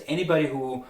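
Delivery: usual speaking voice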